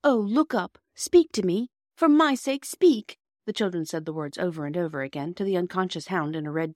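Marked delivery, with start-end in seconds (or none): 0.0-3.2 high, loud plea to wake up; urgent pleading. A narrator's reading. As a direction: beg him to respond. Children plead with unconscious boy.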